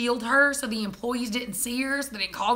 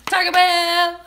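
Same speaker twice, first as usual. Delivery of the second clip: louder, higher cry of Taco Bell; excited shout; 7 semitones higher, 7 dB louder, much slower than usual. Real, not acted. Wife signing off hungry, an excited cry. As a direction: cheer for the food. Excited about late-night food.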